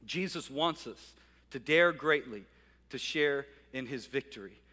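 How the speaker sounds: ordinary delivery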